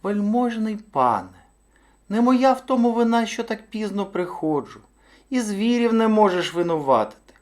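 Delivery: high-pitched, deferential little hare; meek high hare voice; most polite hare. A narrator's reading. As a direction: plead your excuse very courteously, high up. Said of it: "як міг, найчемніше".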